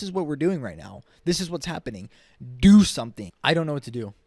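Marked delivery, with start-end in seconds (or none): 2.6-3.3 much louder, a real outburst; shouted demand. Real, not acted. Ranting at politicians, a real loud outburst. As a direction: bark the demand.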